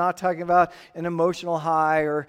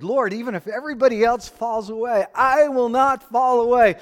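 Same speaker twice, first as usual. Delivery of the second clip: a hint of Peter swearing loyalty, rising louder to the end; bold Peter declaring; 5 semitones higher, 4 dB louder than usual. Preacher quoting Peter's bold vow to Jesus, louder. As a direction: declare it like you'd never fail.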